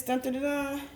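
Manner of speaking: high, playful dun-dun-dun fanfare; vocal drumroll building up to a reveal; mock drumroll. Teacher revealing the last activity to pupils, playful sung drumroll.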